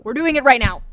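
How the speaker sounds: declared loud and flat out; loud announcement. Pretend. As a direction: say it out loud to everyone. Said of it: "whisper then loud".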